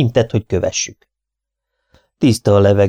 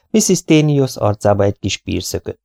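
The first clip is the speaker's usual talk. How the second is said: plain reading voice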